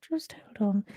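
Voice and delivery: whispered under her breath. Real, not acted. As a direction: drop to a private whisper. Hold message muttered aside.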